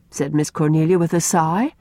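ordinary delivery

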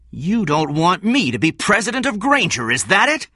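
high, loud, furious accusation; angry shout. A narrator's reading. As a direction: shout it in red-faced anger. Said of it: "he shouted".

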